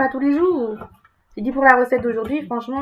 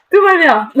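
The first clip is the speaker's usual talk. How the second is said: laughing; 6 semitones higher, 7 dB louder than usual. Real, not acted. Laughs around the line.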